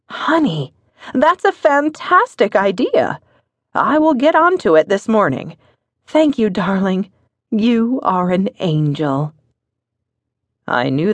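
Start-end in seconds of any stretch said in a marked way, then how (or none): 0.1-9.3 delighted wife. Acted. Wife thanking husband for the maid idea, delighted. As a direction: gush with pleased thanks.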